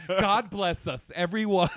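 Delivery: slow Tiny Tim line. Retold or imitated. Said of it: "I'm like".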